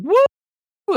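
high gleeful woo; excited whoop. Real, not acted. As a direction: whoop with glee. Cheering at baby being thrown.

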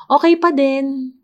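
high, held mock reply; sarcastic stock answer. Last step in the mock range of emotions, sarcastic. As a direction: pitch it high, as if topping the range. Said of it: "I'm being sarcastic".

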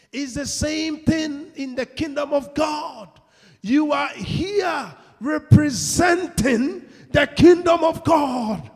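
slow, high, shouted proclamation; full-force preaching. Real, not acted. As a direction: proclaim it to the whole church.

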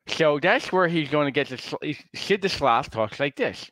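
put-on slurred, slushy drunken talk; drunk Sid the Sloth. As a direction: slur it like a tipsy cartoon sloth. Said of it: "drunk voice and talk like this".

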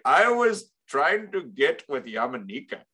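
imitated Indian accent; aggrieved impression with an Indian accent; Raj. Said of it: "that accent has been canceled".

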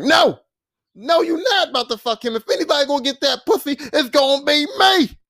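high, loud, possessive objection; snapping out. Retold or imitated. Imagined James stopping her with the other man, snapping out. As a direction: imitate a jealous outburst, stretching the final claim. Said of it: "James was gonna snap out".

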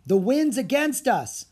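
high, loud sailor's call; strained call over the wind. Acted. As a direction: call it out slowly against the wind. Sailor calls out while rowing against wind.

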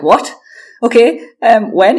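high, loud, caught off guard; retold startled scramble; flustered surprise. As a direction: stammer it out, thrown by the news. Coach says come to Germany now.

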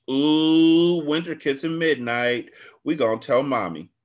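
put-on high, sing-song kid teasing; teasing little girls. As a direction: tease like nosy little sisters. Little girls tease at window.

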